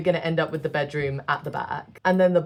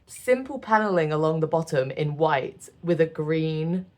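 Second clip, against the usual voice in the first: normal talking voice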